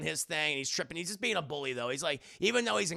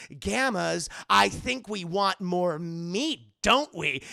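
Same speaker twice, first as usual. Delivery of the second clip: loud, slow smug drawl; Bradley's line; 7 dB louder, much slower than usual. Retold or imitated. Bradley telling his frat brothers they want more meat. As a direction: savor every word like a smug frat bully. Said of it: "uttered by Bradley Uppercrust the third".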